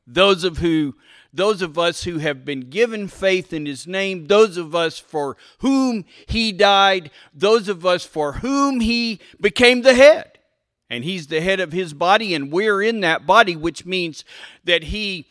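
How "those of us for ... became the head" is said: rising louder, higher, more forceful; building preaching. Acted. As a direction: build the list to a climax.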